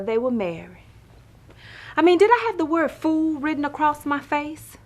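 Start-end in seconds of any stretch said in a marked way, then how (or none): 1.9-4.8 high-pitched indignant rant; exasperated stage rant. Acted. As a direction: protest it in disbelief, pitched well up. Indignant rant about lying men.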